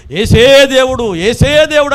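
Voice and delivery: loud, slow, drawn-out worship cry; fervent proclaiming. Acted. Preacher voicing David's longing for worshippers, slow fervent cry. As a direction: proclaim each word slowly like a worshipper.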